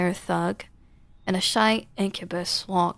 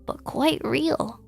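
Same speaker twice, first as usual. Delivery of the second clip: high, flat machine boss praising a worker; cold algorithmic pride, pitched up; robotic manager; 6 semitones higher than usual. Acted. Robot manager praises the cashier, high mechanical voice. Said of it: "I am feeling an emotion your kind describes as".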